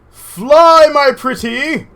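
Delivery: high, loud wicked witch cry; witch cackle line. Pretend. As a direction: fling it out like a gleeful storybook witch. Witch quote launching rocket.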